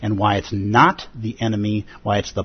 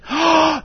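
sharp high gasp of delight; excited gasp. Retold or imitated. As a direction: gasp as if struck by the news. Excited reaction to a wedding.

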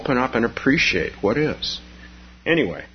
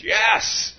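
eager yes; 12 semitones higher than usual. Acted. Second meditator accepts support.

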